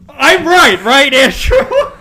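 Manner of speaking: very high, loud victory yell; triumphant shout. Real, not acted. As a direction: scream it out in gleeful triumph. Triumphant over own theory.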